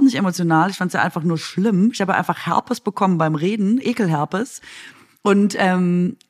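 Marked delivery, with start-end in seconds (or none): none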